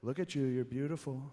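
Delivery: a hint of Trump handing out a compliment; mild Trump voice doling out flattery; Trump impression. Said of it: "he was like".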